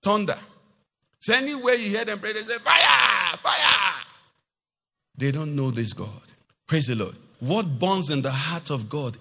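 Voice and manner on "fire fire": loud, drawn-out shouted cry; imitated prayer shout. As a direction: mimic a fervent prayer warrior yelling. Imitates how they pray.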